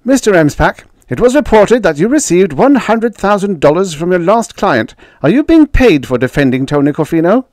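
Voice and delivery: very high, loud question; reporter calling out. A narrator's reading. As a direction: shout it from a press pack. Reporters call questions to Jake.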